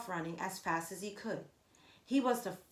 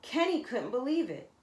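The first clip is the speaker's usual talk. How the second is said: usual speaking voice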